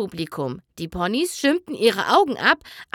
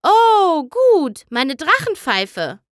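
high, loud burst of delight, then slower; eager Trixie; 6 semitones higher, 6 dB louder, slower than usual. Acted. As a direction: Trixie finds her pipe and perks up. Trixie packs, exclaims to herself. (aufgeregte Trixie, hoch und laut)

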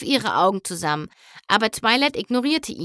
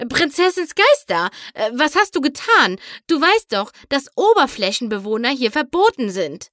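higher, louder startled scolding; shocked alarm; 5 semitones higher, 5 dB louder than usual. A narrator's reading. Queen Novo spots the surface ponies, alarmed. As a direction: gasp then reproach. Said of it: "schnappte sie erschrocken nach Luft".

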